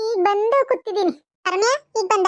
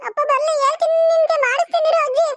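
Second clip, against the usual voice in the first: put-on very high child's threat; tattling threat; 9 semitones higher than usual. Granddaughter warns grandmother she will tell father, threatening. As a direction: threaten to tell, pitched right up.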